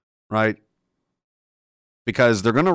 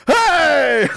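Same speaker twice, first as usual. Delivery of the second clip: mock shout; 12 semitones higher, 4 dB louder than usual. Mock cop yelling at fleeing cars.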